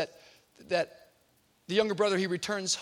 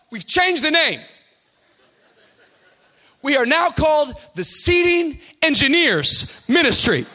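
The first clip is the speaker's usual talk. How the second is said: loud, high, proud announcement; proud tough kid; 8 semitones higher, 11 dB louder than usual. Retold or imitated. Tough young guy proudly telling his pastor the new name. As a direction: announce it like big news.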